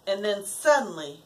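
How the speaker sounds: ordinary delivery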